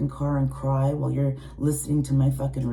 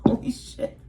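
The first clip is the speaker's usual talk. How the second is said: ordinary delivery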